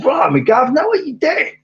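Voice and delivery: high, loud mock London accent; attempted London accent. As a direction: shout it in a hammed-up London accent. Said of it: "I can't do London".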